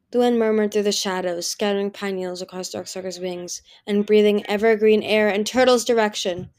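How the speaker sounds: ordinary delivery, nothing marked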